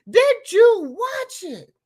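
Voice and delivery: high, loud, slow demand; incredulous. Real, not acted. Pressing the viewers again, high and loud. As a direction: press them in disbelief. Repeated outraged question.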